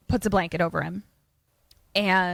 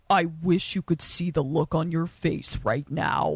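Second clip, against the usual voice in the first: creepy vampire voice; 3 semitones lower than usual. Retold or imitated. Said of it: "and his voice is different".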